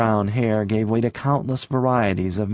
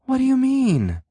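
much higher, bewildered and shaken; faltering. A narrator's reading. Sylvia to Rick as he throws her out, faltering. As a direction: stumble over the question in shock. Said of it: "she faltered".

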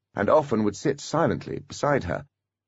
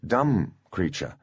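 plain reading voice

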